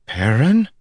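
high, rising surprised question; startled question. A narrator's reading. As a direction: say the name in disbelief. Egwene startled to see Perrin.

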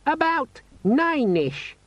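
very high, slow squeak; squeaky, friendly demon. A narrator's reading. Pocket demon giving the time, squeakily friendly. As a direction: announce it in an irritatingly friendly squeak. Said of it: "squeaky, friendly quality that begs to be strangled".

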